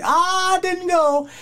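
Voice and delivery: recreate the anguished yell; scream of pain. Retold or imitated. Retelling the wrestler's pained scream, high anguished yell. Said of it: "he's just screaming in pain".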